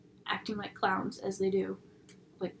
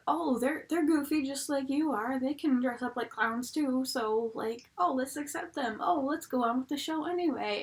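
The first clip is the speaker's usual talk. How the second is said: higher, pushed mock-naive voice; sarcastic imitation; 4 semitones higher, 4 dB louder than usual. Mocking a crowd that accepts the liars, sarcastic. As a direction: play up how easily they are won over.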